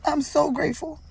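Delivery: high, soft, choked with tears; whisper-close, overcome with gratitude; teary and breaking; tearful thanks. Real, not acted. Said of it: "But I'm overwhelmed".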